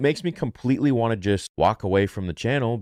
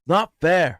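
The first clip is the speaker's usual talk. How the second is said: higher, louder sulky protest; Anakin whine; 10 semitones higher, 5 dB louder than usual. Retold or imitated. Fan as Anakin complaining about the council, loud whine. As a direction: stamp out "Not fair" like a petulant Jedi. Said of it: "Goated impersonation".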